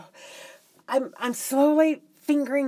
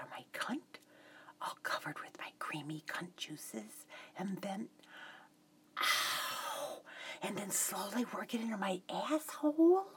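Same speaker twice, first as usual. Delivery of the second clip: sultry whisper; 6 semitones lower, 14 dB quieter than usual. Acted. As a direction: whisper it seductively.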